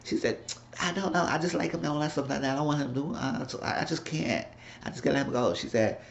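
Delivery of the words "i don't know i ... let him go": put-on higher and softer, a shy young woman admitting a crush; lightly voiced bashful girlfriend; timid girlish voice. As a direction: say it small and unsure, like confessing. Esperanza voiced confessing feelings.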